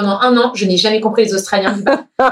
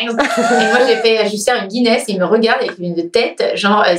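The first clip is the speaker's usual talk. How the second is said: ordinary talk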